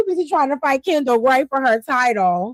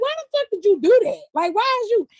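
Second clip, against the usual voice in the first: mock outrage; 7 semitones higher than usual. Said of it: "Now I'm like".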